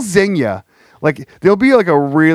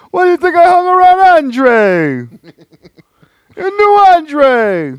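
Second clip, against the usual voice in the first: Pat Patterson=48; 6 dB louder, slower than usual. Retold or imitated. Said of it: "Pat Patterson".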